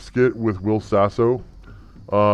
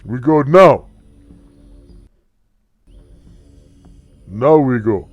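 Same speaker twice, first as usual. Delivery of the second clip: loud, raised, punchy order; commanding leader; 3 semitones higher, 8 dB louder than usual. Acted. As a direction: order them to move at once, forcefully. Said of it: "says Anbu".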